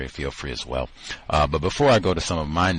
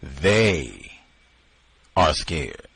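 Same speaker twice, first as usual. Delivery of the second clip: "They" held long, rising then falling; stress on THEY; 3 semitones lower, much slower than usual. Shown as a demonstration. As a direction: isolate "They" with a pause after it. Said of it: "for emphasis".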